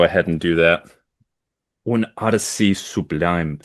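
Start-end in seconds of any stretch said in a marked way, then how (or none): 1.8-3.6 the title read with a French accent; French pronunciation. Shown as a demonstration. Host reading a French book title aloud, French accent. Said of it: "you sound French to me".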